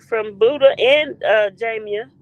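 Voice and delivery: ordinary talk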